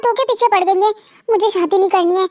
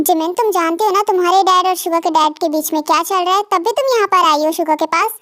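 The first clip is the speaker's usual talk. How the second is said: usual speaking voice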